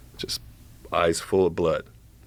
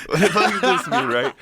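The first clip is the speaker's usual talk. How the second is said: high, laughing, louder question; laughing through words; 12 semitones higher, 4 dB louder than usual. Real, not acted. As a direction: say it while cracking up. Laughing while quoting himself.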